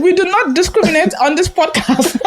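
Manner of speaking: high-pitched playful protest, half laughing; mock-indignant telling-off on the edge of laughter; mock scolding. Jokingly scolds co-host over accents.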